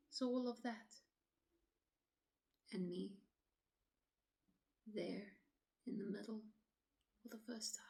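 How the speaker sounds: quiet, halting dramatic monologue, long pauses; troubled woman recounting. Acted. Woman telling officer of walking alone at dusk, uneasy and hushed. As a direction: relive it softly, leaving silences between phrases.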